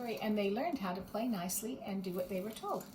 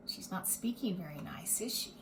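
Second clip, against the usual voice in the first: hushed aside; 2 semitones lower, 5 dB quieter than usual. Real, not acted. Aside to listening child.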